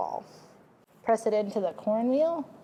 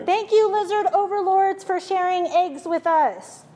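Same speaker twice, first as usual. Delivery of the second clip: mock thanks; 7 semitones higher, 5 dB louder than usual. Mock prayer to lizard overlords.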